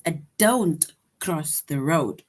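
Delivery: slow stern command. Shown as a demonstration. Teacher reading a command aloud, slow and firm. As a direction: say it like a firm order.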